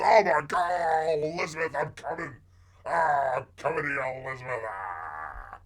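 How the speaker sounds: hammed-up dying man calling out to Elizabeth; mock heart attack. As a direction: groan and wail as if your heart gave out. Acts out a sudden heart attack.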